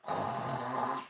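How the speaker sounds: snore. Acted. Dog snoring in the story.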